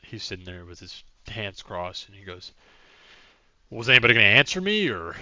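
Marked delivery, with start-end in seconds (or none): 3.7-5.2 loud, fast, impatient demand. Acted. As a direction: snap at them for an answer. Mayor impatient at silent party.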